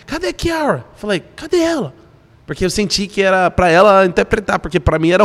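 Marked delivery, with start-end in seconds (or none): 0.1-0.8 high-pitched searching call; retold urgent call, higher voice. Retells calling out for Kiara. 1.4-1.9 high-pitched searching question; retold searching call, pitched up. Retells calling out for her.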